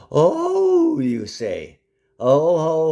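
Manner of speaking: high scoffing oh-ho; mocking exclaim. Real, not acted. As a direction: scoff loudly.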